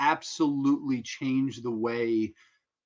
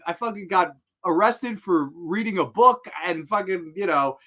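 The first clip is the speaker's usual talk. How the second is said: mock rant; 5 semitones higher, 6 dB louder than usual. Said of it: "He goes".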